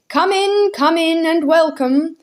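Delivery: a hearty uncle calling out; loud cheery call. A narrator's reading. Uncle welcoming guests, cheery call. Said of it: "called a loud, cheery voice".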